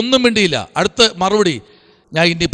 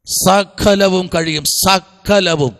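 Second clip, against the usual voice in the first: "സകലവും" stretched out very slowly; emphatic repetition; 2 semitones lower, much slower than usual. Real, not acted. Preacher assures congregation God can do all, "സകലവും" slowly drawn out. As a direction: draw out every syllable of "സകലവും".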